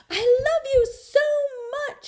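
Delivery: very high, loud yell, near crying; girl yelling love to her teacher. A narrator's reading. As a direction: call it after the teacher. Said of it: "she yelled".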